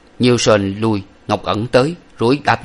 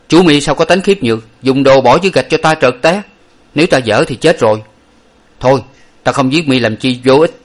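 higher, louder scolding; stern rebuke; 4 semitones higher, 6 dB louder than usual. A narrator's reading. Hero rebukes the cheater he has pinned, loud and stern. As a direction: scold him with the blade at his throat.

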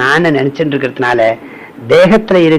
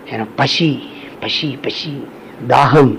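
softer, fading cry of hunger; weak hungry moan; 2 semitones lower, 6 dB quieter, slower than usual. Retold or imitated. Starving person moaning for food, voice fading softly. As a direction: let the voice sink with hunger. Voicing someone faint with hunger.

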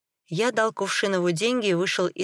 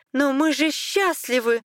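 high, desperate protest; almost crying; 8 semitones higher than usual. A narrator's reading. Wife clings to their marriage, almost crying. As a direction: plead high, on the edge of tears. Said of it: "Она почти плакала".

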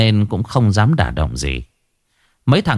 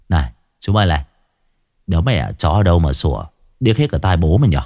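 irritated grumble; 5 semitones lower, faster than usual. Acted. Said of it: "bực bội vu vơ".

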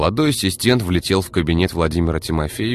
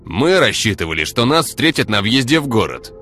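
loud, haughty, higher-pitched complaint; indignant and self-important, voice raised; arrogant; 6 semitones higher, 5 dB louder than usual. A narrator's reading. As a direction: scold like an affronted superior. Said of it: "Тон говорившего был заносчив".

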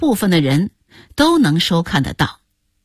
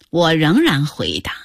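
plain reading voice

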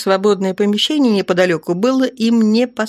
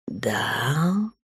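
drawn-out yes; 3 semitones lower, 7 dB quieter than usual. A narrator's reading. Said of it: "тягуче подтвердил он".

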